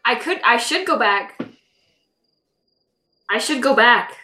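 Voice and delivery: holding back hiccups; suppressing hiccups. Real, not acted. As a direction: talk while fighting hiccups. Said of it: "Sorry, I have hiccups".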